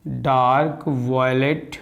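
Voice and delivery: Dark violet drawn out slowly; slow emphasis. Shown as a demonstration. Teacher repeating the colour for students to note, slowly. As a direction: stress dark and stretch dark violet. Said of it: "तो मैंने बोला".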